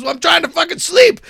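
high, loud mock outrage; exasperated, high-pitched retold yell; retold angry shout. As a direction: imitate someone yelling after being woken. Retold quarrel, woken man complains.

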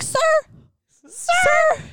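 very high, loud outraged Sir; a shrieked protest at dad; exasperated cry. Retold or imitated. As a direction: squeal it at him in disbelief.

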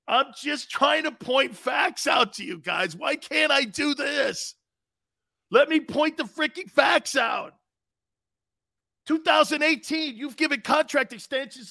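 shouting to be heard over the callers; exasperated shout. Real, not acted. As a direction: plead for the floor, frustrated.

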